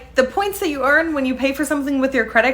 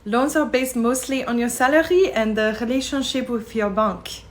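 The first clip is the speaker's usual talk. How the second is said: put-on French accent. Frenchwoman explains France to American tourist, French accent. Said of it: "a very impressive French accent".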